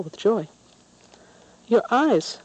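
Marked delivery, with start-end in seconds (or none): 1.7-2.4 put-on higher little girl's voice announcing; a child's matter-of-fact pronouncement. A narrator's reading. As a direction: announce it like a bold little girl. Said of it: "the little girl announced one day".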